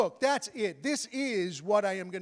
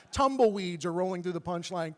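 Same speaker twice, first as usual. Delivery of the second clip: ordinary talk, nothing marked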